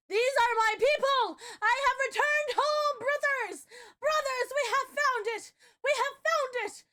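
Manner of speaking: high, loud triumphant cry of a returning elf; elf returning home. Pretend. Streamer pretending to be a Fae elf, high and loud. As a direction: shout as if you just found your lost kin. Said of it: "Okay, I'm sorry".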